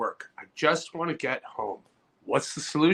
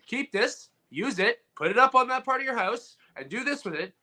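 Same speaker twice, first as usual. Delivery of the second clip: bossy gift giver; 7 semitones higher than usual. Retold or imitated. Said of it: "it's not like".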